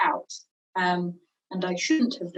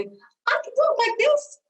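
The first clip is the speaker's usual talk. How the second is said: high pitch; much slower than usual. Shown as a demonstration. Voice coach demoing a pitch change to students, high and slow. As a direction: talk slowly way up high in the voice. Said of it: "That's a difference in pitch".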